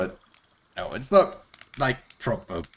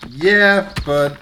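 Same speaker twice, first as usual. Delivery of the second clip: excited; 10 semitones higher, 9 dB louder, much slower than usual. Real, not acted. Excited about silent disco.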